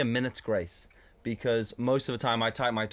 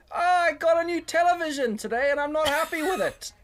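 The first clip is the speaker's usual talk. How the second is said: high, loud call to a noisy room; high, loud announcement to a crowded pub; mock loud announcement; 5 dB louder than usual. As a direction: blurt it out to nobody in particular.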